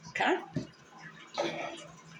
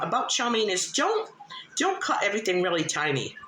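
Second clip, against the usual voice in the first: ordinary delivery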